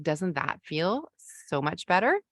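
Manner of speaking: normal talking voice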